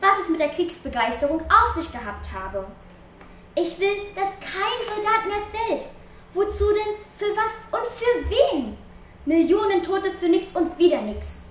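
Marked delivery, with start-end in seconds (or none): 3.6-11.4 indignant outcry. A narrator's reading.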